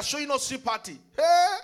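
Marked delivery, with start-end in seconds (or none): 1.2-1.6 sudden loud high cry; shout. Acted. Preacher jolting the congregation, loud shout. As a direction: burst out with a shout.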